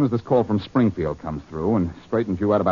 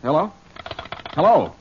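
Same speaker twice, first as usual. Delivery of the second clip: raised, questioning calls into a dead line; insistent phone hello; 7 semitones higher, 3 dB louder than usual. Acted. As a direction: call higher and louder each time. Caller has just been hung up on.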